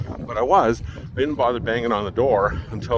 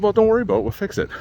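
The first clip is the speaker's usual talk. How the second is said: mocking brush-off; 11 semitones higher, 5 dB louder, much faster than usual.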